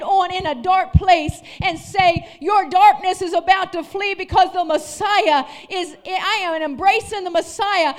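loud, rising sermon cadence; fired-up pulpit preaching; preaching at full force. Acted. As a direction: preach it to the whole room.

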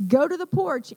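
firm, emphatic warning command; cautioning voice. Shown as a demonstration. Nana warns Georgia there might be danger, cautioning command. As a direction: demonstrate the voice that means possible danger. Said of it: "in that voice".

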